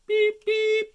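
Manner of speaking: very high loud beeping. Acted. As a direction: beep it shrill and loud. Horn sound in story.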